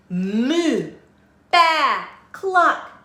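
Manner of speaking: loud, rising moo, baa, cluck; animal noises. A narrator's reading. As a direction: call out the farm animal noises. Animals answer together.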